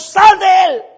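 high, loud chanted order together; group shout. Acted. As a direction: everyone yell it together. Todos juntos, muchachos.

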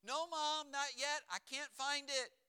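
put-on high, loud kid's reply; imitated boy shouting his excuse; calling back. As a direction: holler down the stairs to mom. Child calling down to mom.

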